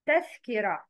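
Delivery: usual speaking voice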